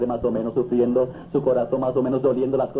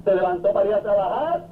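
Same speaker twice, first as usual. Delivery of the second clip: ordinary talk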